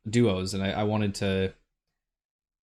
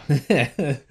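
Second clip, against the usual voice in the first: ordinary delivery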